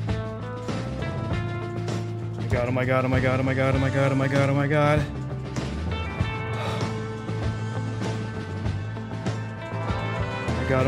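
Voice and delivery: low, breathless frantic chant; panicked repeated oh my god. Real, not acted. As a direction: whisper oh my god over and over in dread. Panic as run nears failure.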